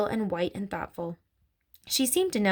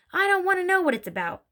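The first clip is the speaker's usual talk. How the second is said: mildly barked, higher-pitched irritation; 10 semitones higher than usual. A narrator's reading. Mister Wormwood cuts off talk of the book, barking. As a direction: cut in with a higher, moderate bark. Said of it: "Mister Wormwood barked".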